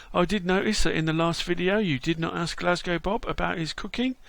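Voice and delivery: ordinary talk, nothing marked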